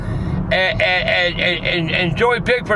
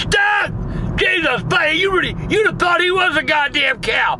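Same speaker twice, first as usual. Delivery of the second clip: exasperated yell; 7 semitones higher than usual. Retold or imitated. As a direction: blow up at the stinky kid.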